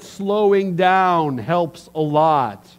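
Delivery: slow, drawn-out words; deliberately over-slow delivery. Shown as a demonstration. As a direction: stretch each word out slowly. Demonstrates slowing down while naming it.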